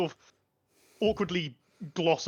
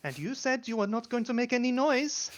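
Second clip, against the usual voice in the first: higher, put-upon voice; wife's retort; 7 semitones higher than usual. Acted. GM voicing Gertrude answering her husband, exasperated. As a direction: snap back at him, exasperated. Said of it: "She goes".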